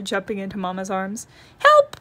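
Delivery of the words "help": very high, loud panicked yelp; scared cry for help. Acted. Brother cub crying out at the thunder, very high and loud. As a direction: leap into Papa's arms crying out. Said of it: "cried Brother".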